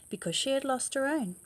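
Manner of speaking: plain reading voice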